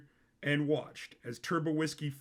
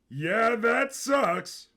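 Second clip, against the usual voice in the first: higher and louder, dragged very slowly; drawn-out high Sisko; 8 semitones higher, 8 dB louder, much slower than usual. A narrator's reading. Narrator voicing Sisko answering his cousin's complaint, high, loud and slow. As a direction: fake sympathy, stretched out.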